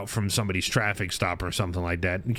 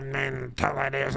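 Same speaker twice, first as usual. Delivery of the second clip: Eddie's smoker voice; 3 semitones higher than usual. Retold or imitated. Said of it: "away from straight up".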